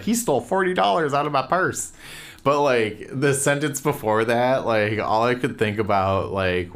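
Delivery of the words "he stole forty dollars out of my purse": mocking reviewer. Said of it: "she's just like".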